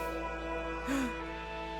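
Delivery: sharp, breathy gasp of alarm. Acted. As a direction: gasp mid-word in fright. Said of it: "she gasped upon speaking".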